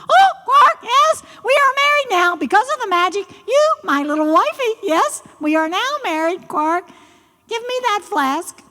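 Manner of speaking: very high, excited frog voice; high-pitched, hurried, gloating frog; triumphant frog character. Acted. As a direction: crow like a frog who has won a bride.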